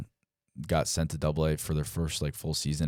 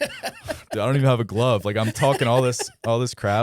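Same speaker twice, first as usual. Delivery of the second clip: loud, high-pitched mock dismay; animated dismay; 7 semitones higher, 7 dB louder than usual. Player caught without a glove after big talk, animated dismay.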